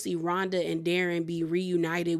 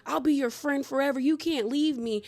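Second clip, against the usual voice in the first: begging villain; 6 semitones higher than usual. Retold or imitated. Crockett crawling, begging the kids.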